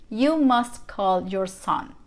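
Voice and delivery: normal talking voice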